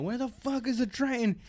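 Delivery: high, soft mock yell; retold yelling. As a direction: imitate repeated yelling with the volume turned down. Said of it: "he keeps yelling it".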